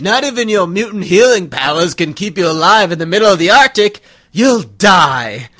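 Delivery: loud, growling villain roar; Sabretooth voice. Acted. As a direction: bellow it like a savage villain. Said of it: "Oh, that hurts my throat".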